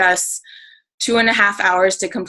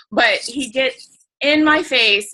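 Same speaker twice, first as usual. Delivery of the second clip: ordinary talk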